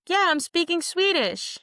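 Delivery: high-pitched, swooping retold reply; mock amazed answer; imitated realization. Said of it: "And she's like".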